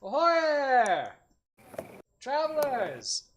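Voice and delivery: high, drawn-out hail across the road; hearty merchant greeting. Acted. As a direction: call out to travelers, cheerful. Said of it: "As you hear one person go like".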